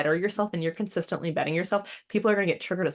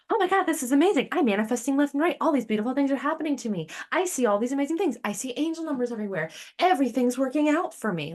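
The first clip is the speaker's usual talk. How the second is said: much higher and fast, gushing; giddy beginner; 6 semitones higher, faster than usual. Retold or imitated. New spiritual seeker gushing, higher and fast. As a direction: rattle off blessings breathlessly. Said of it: "It's like".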